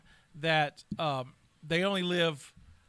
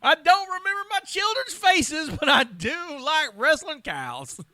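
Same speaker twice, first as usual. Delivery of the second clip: put-on high, loud, whiny old cowboy; old Western codger; 12 semitones higher, 10 dB louder than usual. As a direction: wail like a senile old rancher. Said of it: "a old-timey prospector in his old age".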